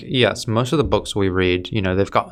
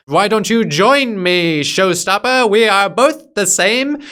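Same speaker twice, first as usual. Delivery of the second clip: higher, louder and slow, a hammy put-on villain voice; hammed-up villain pitch; 9 semitones higher, 3 dB louder than usual. Reviewer mocking the villain inviting Tom to join him, hammy and slow. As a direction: gloat like a cartoon villain recruiting the hero. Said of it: "that tropey scene where he explains".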